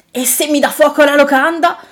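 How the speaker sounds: high, loud, fretful objection; alarmed innkeeper. A narrator's reading. Innkeeper refusing to hire a dragon, alarmed.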